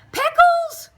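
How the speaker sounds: high loud call across the yard. A narrator's reading. Missus Goodkind calling the cat in the tree, loud high call. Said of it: "she called".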